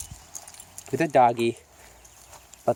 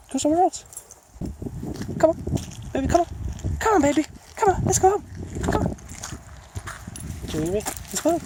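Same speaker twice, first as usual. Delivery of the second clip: very high coaxing pet voice; much faster than usual. Real, not acted. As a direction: coax the dog sweetly, very high.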